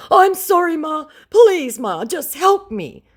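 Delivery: very high, loud pleading whine; begging scammer. Retold or imitated. Scammer begging her in a very high whine. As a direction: beg Ma desperately.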